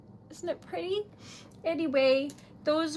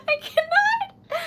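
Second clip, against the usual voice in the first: high, slow, overwhelmed squeal; screeching; 5 dB louder, slower than usual. Real, not acted. Streamer overwhelmed by fans' kind words, screeching high. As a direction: squeal it out, choked up with joy. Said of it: "Oh, I'm screeching".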